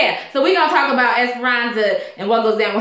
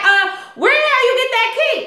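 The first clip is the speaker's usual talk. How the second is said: higher, fast, incredulous challenge; indignant demand; 12 semitones higher, faster than usual. Retold or imitated. Esperanza demands to know where Ed got her key, higher and fast. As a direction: snap the question out in disbelief.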